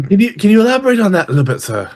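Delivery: lightly voiced, puzzled servant; night-shift servant. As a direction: servant asking the master to clarify.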